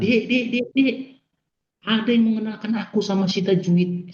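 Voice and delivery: high-pitched, delighted retold call; happy calling out. Husband rushing home with good news for his wife, happy calling out. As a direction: call out excitedly, pitched high, without full shouting. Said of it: "Saking senangnya saya teriak sama istri".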